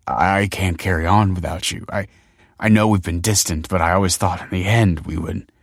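plain reading voice

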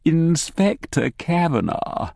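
slow posh Edinburgh Morningside greeting; posh Morningside accent. Acted. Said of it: "speaks with an ultra-posh Morningside accent".

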